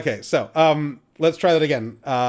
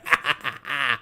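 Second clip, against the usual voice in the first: witch cackle; 2 semitones lower than usual. Acted. Witch laughs at captive.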